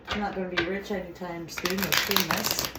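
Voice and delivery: ordinary talk, nothing marked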